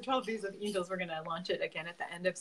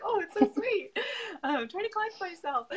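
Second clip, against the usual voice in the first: high, giggly and flustered; 13 semitones higher than usual. Real, not acted. Host flustered by a guest's praise, high giggly squeal. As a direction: squeal it out, then fight to regain composure. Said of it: "I'm kind of flustered".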